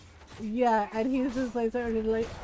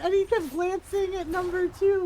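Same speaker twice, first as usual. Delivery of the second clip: normal talking voice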